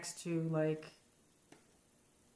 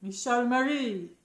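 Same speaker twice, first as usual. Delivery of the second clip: put-on slow, higher Italian-accented call of the name; 5 semitones higher, 4 dB louder than usual. Granddaughter mimicking grandmother's name call in Italian accent. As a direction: sing out the name like an Italian nonna. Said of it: "she had, like, an Italian accent".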